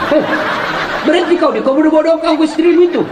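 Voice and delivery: preacher laughingly rebuking a husband; laughing scold. Retold or imitated. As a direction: tell him off while laughing.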